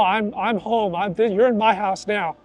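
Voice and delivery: usual speaking voice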